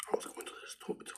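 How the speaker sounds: unvoiced confiding whisper. Real, not acted. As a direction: let it slip like a secret. Confiding a small errand.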